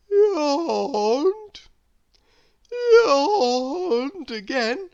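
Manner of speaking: big drawn-out yawn, high and loud; slow sleepy yawning through the words. A narrator's reading. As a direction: stretch it into a huge sleepy yawn. Narrator acts out Lazy's yawns.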